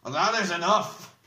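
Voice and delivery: loud, high command to stop; cried out. A narrator's reading. Oric leaping in to stop the attack, crying out. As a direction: cry out to break up a fight. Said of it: "then leapt forward and cried".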